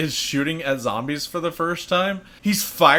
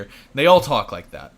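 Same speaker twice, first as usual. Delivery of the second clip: ordinary talk, nothing marked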